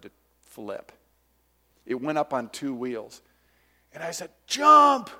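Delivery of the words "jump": sudden high loud yell; shouted warning. Retold or imitated. Preacher retelling the mower flip, yelling jump. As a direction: yell it to save your sister.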